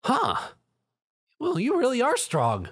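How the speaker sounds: high, surprised remark; a grudging admiring huh; impressed surprise. A narrator's reading. Rainbow Dash, surprised by Zio's strength, high. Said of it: "she remarked".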